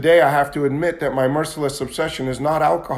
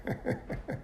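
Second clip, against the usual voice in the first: soft laugh; 17 dB quieter than usual. Real, not acted. Laughing at his own joke.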